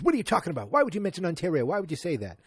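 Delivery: high, loud mock-agitated grouch; Archie Bunker. Host as father confronting son-in-law over Ontario, mock agitation. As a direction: pepper them with indignant questions. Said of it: "And then Archie goes".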